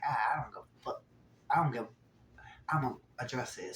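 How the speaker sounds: hushed, half-whispered resolve; muttered aside. Retold or imitated. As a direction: whisper it to yourself before stepping in. Tommy's inner thoughts voiced.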